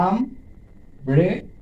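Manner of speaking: "Umbrella" as আম, ব্রে, লা with pauses; three slow separate beats in umbrella; syllable by syllable. Shown as a demonstration. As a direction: demonstrate each syllable of umbrella alone. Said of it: "তিনবার স্পর্শ করছে".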